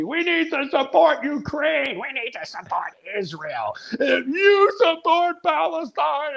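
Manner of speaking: high, drawn-out mock slogans; goofy mimicry. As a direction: whine it like a silly media chant. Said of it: "it's a nonstop goofy".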